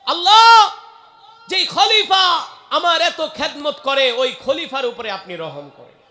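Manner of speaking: high, loud wailing cry to Allah, easing down; acted tearful plea. Blind one praying for the caliph, acted loud wail. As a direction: cry out the prayer at full voice, then let it fall. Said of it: "অন্ধ কান্না কইরা বলে".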